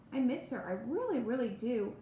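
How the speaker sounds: ordinary delivery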